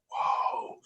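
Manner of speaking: soft, breathy "whoa" of wonder; awed toddler. Retold or imitated. Dad imitating his two-year-old watching Superman fly, hushed awe. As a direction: imitate a small child's hushed amazement. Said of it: "I have a two-year-old".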